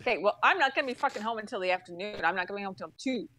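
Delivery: fast, irritated comeback; retold firm reply. Customer to agent about tomorrow's visit, quick irritated reply. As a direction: rattle it off, fed up. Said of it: "this is the conversation I had".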